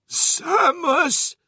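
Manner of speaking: high, strained gasp of a name. A narrator's reading. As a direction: gasp it out, dying. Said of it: "he gasped".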